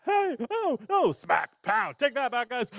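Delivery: a kid playing superhero, whooping; action fight cries. Bear and friends fight bad guys with noisy battle cries.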